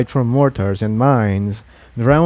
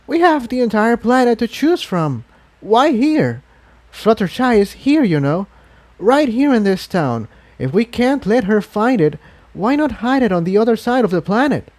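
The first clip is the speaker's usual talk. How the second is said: put-on higher young dragon voice, puzzled and pleading; Spike's voice; 10 semitones higher than usual. A narrator's reading. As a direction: raise the voice to play a worried young Spike.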